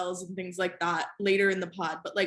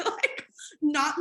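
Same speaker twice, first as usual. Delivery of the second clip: laughing; 7 semitones higher than usual. Real, not acted. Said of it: "laughed hysterically".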